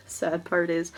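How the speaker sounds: ordinary talk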